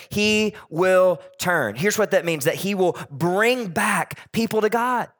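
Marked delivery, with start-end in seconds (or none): 0.1-1.8 slow emphatic quote. Shown as a demonstration. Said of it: "It says".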